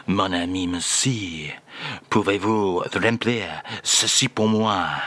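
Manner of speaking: a lightly done [name] with a French lilt, slowly; mock French [name]. [name] reads the French phrase to the host in a mock French accent. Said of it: "Again, please, [name]".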